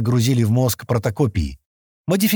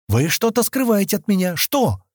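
high-pitched demanding exclamation; exclaiming; 9 semitones higher than usual. A narrator's reading. Kenni suspecting they hide something from her, exclaiming. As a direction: exclaim it, pitch well up, pressing for an answer. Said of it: "воскликнула Кенни".

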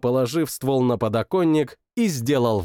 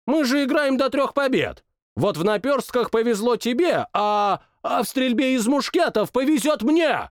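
insistent king; 10 semitones higher than usual. Acted. King insists on another contest, high and rising. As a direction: push the last words out harder. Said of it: "Последние слова он произнёс громче остальных".

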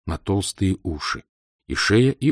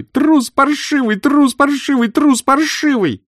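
higher, louder, slow tearful yell; tearful shout; 6 dB louder, slower than usual. A narrator's reading. Aglaya shouting down the stairwell at Senya, tearful. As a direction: scream it after him, near tears. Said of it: "крикнула она плачущим голосом".

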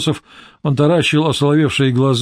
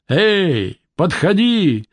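booming herald's call, pitched higher; town crier; 5 semitones higher, much slower than usual. Acted. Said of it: "кричал он зычным голосом глашатая".

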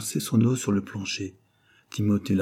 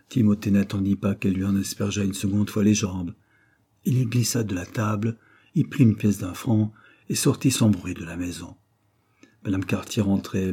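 ordinary delivery

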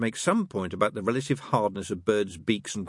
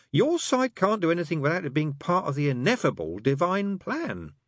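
smug; 10 semitones higher than usual. Acted. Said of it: "with a trace of smugness".

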